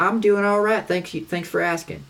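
a mild put-on country voice; country accent. Answering a country local in his country accent. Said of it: "my country accent comes out heavy".

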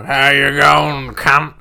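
slow, drawn-out ocker Aussie greeting; exaggerated broad Aussie drawl; broad Aussie accent. Shown as a demonstration. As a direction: lay on a thick Australian accent. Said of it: "full-blown ... Aussie accent".

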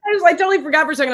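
flustered rush. Real, not acted. Fast embarrassed laugh-talk.

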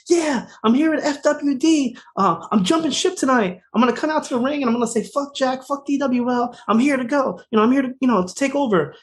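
high-pitched, amped-up imitation of a wrestler; excited retelling. Wrestler boasting on the phone about jumping ship, excited. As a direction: act out a coked-up man bragging. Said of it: "he's all excited".

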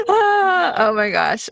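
high burst of laughter, then slow; real giddy laughter. Real, not acted. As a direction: laugh it out, thrilled and a little nervous. Giddy after naming the vet.

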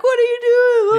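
hammed-up cry of disbelief, stretched at the end; mock horrified shout. Imitating onlookers as the rope is cut, mock horror.

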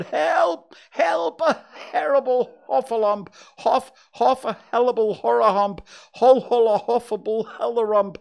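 high, loud, tongue-tied panic; Piglet crying out. A narrator's reading. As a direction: yell the alarm, tripping over the words. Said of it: "still crying out loud".

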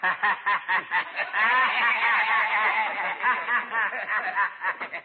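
hammed-up merry laughter; forced laugh. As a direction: laugh big so the guest hears it. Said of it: "laugh anyway".

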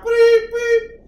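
loud, high-pitched alarm imitation; imitated alarm beeps. Alarm going off after two hours' sleep, loud imitated beeping. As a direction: blare it like an alarm clock. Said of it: "then an alarm".

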